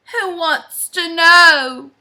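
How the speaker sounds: slow, loud, drawn-out demand; lightly growled voice from inside; storybook growled challenge. Acted. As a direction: challenge the stranger slowly and loudly. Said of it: "growled a voice from inside".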